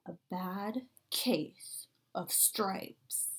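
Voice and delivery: hushed, slow title reveal; breathy mystery whisper. Acted.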